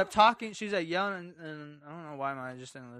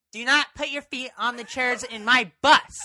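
a slow, loud, higher bus driver barking a rule; bus driver over the intercom; 7 semitones higher, 18 dB louder, slower than usual. Retold or imitated. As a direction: announce the rule slowly and loudly, like a fed-up driver. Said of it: "That's what she said over the intercom".

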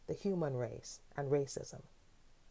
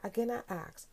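normal talking voice

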